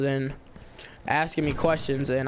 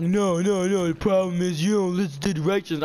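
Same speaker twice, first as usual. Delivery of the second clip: mildly raised voice of a scolding helper; retold yelling; 3 semitones higher than usual. Helper scolding a student over directions, mock yelling. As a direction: scold firmly, a touch of yelling, imitated. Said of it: "he started yelling again".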